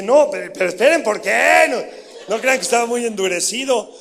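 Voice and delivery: loud, pleading objection; retold loud protest. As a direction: push back hard, almost shouting.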